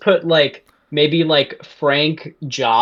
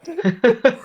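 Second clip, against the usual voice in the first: high laugh; 9 semitones higher than usual. Real, not acted. Laughs at Frank lore.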